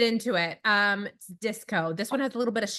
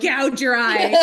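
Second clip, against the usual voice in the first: 1 mock menace; 2 semitones higher, 8 dB louder, much slower than usual. Joking about kid's roughness.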